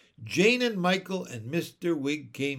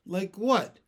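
sharp, raised question; 5 semitones higher, 4 dB louder than usual. A narrator's reading. Mary Poppins suspecting an offence, sharp. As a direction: cut in as if offended. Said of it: "said Mary Poppins sharply".

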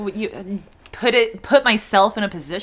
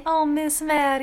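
higher, put-on Southern lilt; light mock Southern accent; 4 semitones higher, 5 dB quieter than usual. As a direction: coo it like a Southern grandma. Joining the accent bit.

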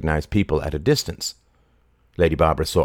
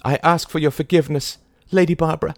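high, loud pleading cry; cried out; 11 semitones higher, 4 dB louder, faster than usual. A narrator's reading. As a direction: cry out begging on her knees. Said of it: "she cried".

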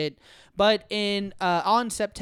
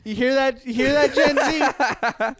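loud, higher call through laughter; mock calling out; 6 semitones higher, 7 dB louder than usual. Host jokingly addressing Gen Z, calling out loudly. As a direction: holler it teasingly to a far-off crowd.

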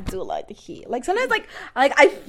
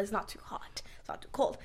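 whispering; 9 dB quieter than usual. Real, not acted. Soft describing of perfect weather.

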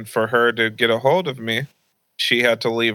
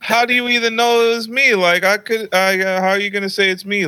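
fast, higher-pitched mock denial; defensive protest; 8 semitones higher, faster than usual. Young son denying it to mom, rapid high protest. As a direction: rush it out like a cornered kid.

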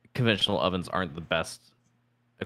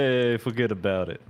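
mock New York tough guy; hammed-up New York accent; 3 semitones higher than usual. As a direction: toss it off like a Brooklyn wiseguy. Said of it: "it's not really a New York accent".

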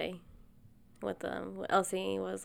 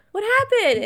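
alarmed; 10 dB louder than usual. Retold or imitated. Panicked reaction to fake cut.

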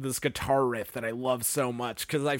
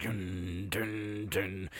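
mock beat; 6 semitones lower, 9 dB quieter, much slower than usual. Imitating the building beat.

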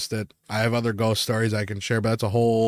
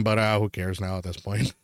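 usual speaking voice

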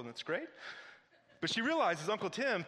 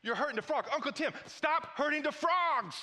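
put-on escalating high-pitched kid outrage; worked-up little niece; 9 semitones higher than usual. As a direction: imitate a small girl scolding her uncle, getting more frantic. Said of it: "she starts getting worked up".

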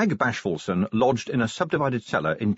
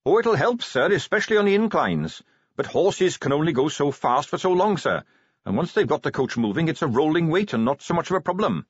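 put-on higher eager young Carrot voice; 5 semitones higher than usual.

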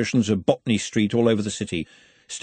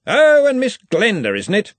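loud, high, delighted greeting; hearty, happy Arch-Chancellor hailing someone; happily; 11 semitones higher, 7 dB louder than usual. A narrator's reading. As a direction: burst out with jovial pleasure. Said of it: "said the Arch-Chancellor happily".

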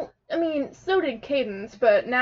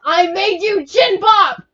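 loud, high, drawn-out protest; defensive yell; 6 semitones higher, 9 dB louder, slower than usual. Real, not acted. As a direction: shout back in your defence. Defending against friend's accusation.

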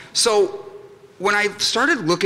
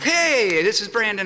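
high, chirpy acted phone hello; cheery phone greeting; 5 semitones higher than usual. Boy answering a telemarketer's call, overly cheery. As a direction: pitch it up, eager to take the call.

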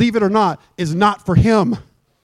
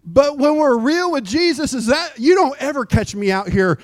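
ordinary talk

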